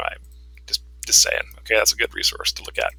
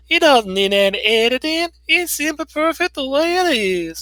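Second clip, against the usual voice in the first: Southern Belle; 14 semitones higher than usual. Retold or imitated. Nancy the Southern Belle refusing edits, in a high Southern Belle voice. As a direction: play a haughty Southern Belle, high and sweet. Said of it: "that's my Southern Belle".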